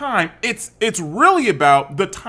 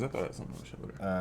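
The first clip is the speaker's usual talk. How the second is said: whispered aside; 10 semitones lower, 20 dB quieter, much faster than usual. Real, not acted. Quick aside under breath.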